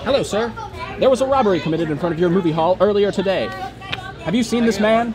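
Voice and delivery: loud, clipped cop interrogation; tough detective. Acted. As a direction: grill the witness like a hard-boiled cop. Tough cop questioning witness.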